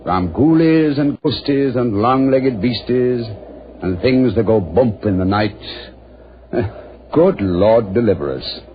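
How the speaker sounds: put-on slow, measured recitation of an old prayer; slow solemn chant of a Scottish litany; solemn recited litany. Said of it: "forcibly reminded of an old Scottish litany".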